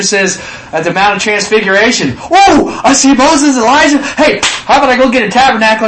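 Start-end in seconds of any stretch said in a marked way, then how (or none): 2.2-5.9 much higher and louder, eager babbling; excited Peter. Acted. Peter at the mountain, eagerly offering tabernacles. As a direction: gush like a starstruck disciple.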